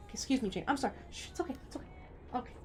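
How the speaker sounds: soft, hushed apologetic soothing; gently calm her down. Real, not acted. Calming a startled pet.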